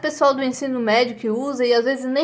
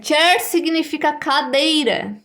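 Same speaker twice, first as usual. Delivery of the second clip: ordinary talk, nothing marked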